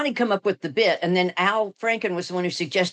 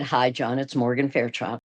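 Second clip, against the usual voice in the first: lower, purring self-introduction on the phone; calling a comedian, lower star voice; own famous voice; 6 semitones lower than usual. Retold or imitated. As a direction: drop low and introduce yourself as the star. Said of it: "it sounds like Morgan Fairchild".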